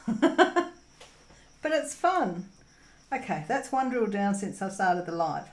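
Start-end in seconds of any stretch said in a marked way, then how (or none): 0.1-2.4 laughing it off, voice jumping higher; a giggle breaking through the words. Real, not acted. Owning up to her mess with a higher, laughing voice.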